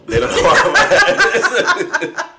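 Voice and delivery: slow, high, laughing through it. Real, not acted. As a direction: laugh as you say it. Laughing while telling drunk story.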